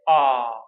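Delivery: "Ah" held long and loud with the mouth wide open; a long open "Ah" like at the dentist; dentist's ah. Shown as a demonstration. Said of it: "open your mouth and say".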